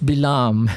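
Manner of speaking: Balaam as "bee-LAHM", two syllables. Shown as a demonstration. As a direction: say Balaam starting "bi", ending in long "lahm".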